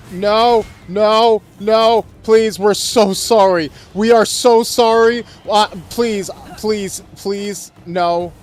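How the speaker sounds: high, loud panicked begging; anguished denial. Pretend. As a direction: plead desperately, voice up high. Begging in-game character not to attack.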